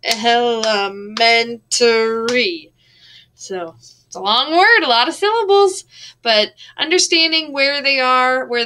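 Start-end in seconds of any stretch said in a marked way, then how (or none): 0.0-2.7 drawn-out lazy elementary; mock-weary slow elementary; lazy, slurred. Shown as a demonstration. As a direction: slur elementary like a tired reader. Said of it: "it becomes like".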